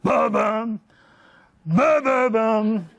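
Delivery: booming ba-bum footsteps; loud stomping footsteps. Retold or imitated. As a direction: stomp it out slowly. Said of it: "in a way that is very distinctively extroverted".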